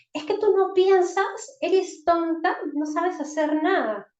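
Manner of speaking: loud, high contemptuous scolding; scolding insult. Shown as a demonstration. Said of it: "Estoy usando un calificativo". (insulto regañón en voz alta)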